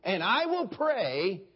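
Schambach impression. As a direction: plead like a radio preacher. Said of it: "here was Brother Schambach, and here was his plea".

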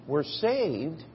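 ordinary talk, nothing marked

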